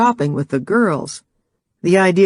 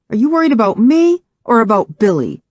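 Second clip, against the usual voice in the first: accusing; 6 semitones higher, 4 dB louder than usual. Acted. Jealous challenge in couple argument.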